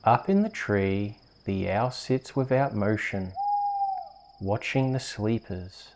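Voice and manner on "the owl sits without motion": ordinary delivery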